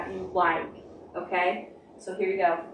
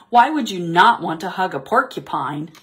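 usual speaking voice

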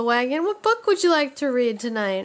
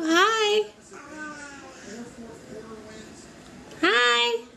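high sing-song coaxing; 7 semitones higher, slower than usual. Real, not acted. Mother coaxing baby to come.

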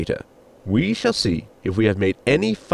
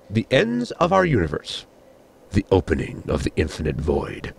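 grand, portentous reveal, sinking much lower at the end; dramatic announcement; 5 semitones lower than usual. Acted. Captain tires of the computer's grand announcements, dramatic intoning. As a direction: intone it like a film trailer voice-over. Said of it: "Are you going to keep doing that?".

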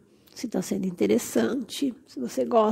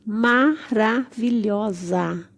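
drawn-out admiration; much slower than usual. Real, not acted. Vlogger showing off a stunning succulent, slow admiring gush. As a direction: marvel slowly, stretching every syllable. Gushing over succulents on display.

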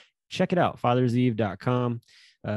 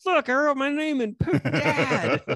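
high voice breaking into laughter; laughing through a proud old voice; laughing old parent; 14 semitones higher, slower than usual. Retold or imitated.

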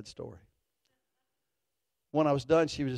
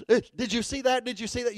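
mock nervous wife; 7 semitones higher than usual.

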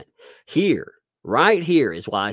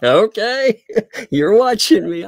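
high, loud playful retort; laughing, exaggerated okay; mock amazement; 12 semitones higher, 9 dB louder than usual. Parent answering child who is watching, high mock amazement.

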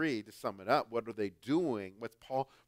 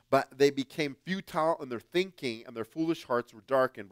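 scripture reading; 2 semitones higher, 6 dB louder than usual. Acted. Preacher reads scripture aloud.

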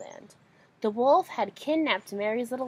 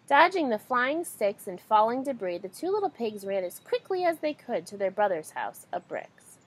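plain reading voice

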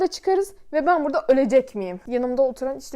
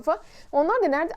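ordinary delivery

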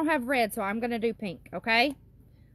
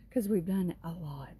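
whispered aside; 6 semitones lower, 5 dB quieter than usual. Real, not acted. Aside to the children.

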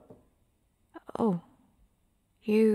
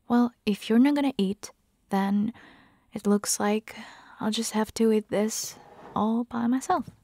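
normal talking voice

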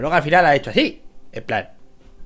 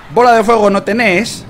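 mock taunt; 6 semitones higher, 6 dB louder than usual. Taunting opponent, Argentine voseo.

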